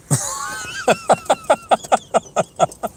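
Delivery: very high giggling laugh; long run of high, staccato giggles; real laugh. Real, not acted. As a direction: crack up at your own joke. Laughs at own gold Uzi joke.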